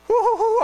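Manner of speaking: high loud woo-hoo-hoo whoop; joyful whoop. Retold or imitated. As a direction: whoop for joy. Voiced Simeon whooping.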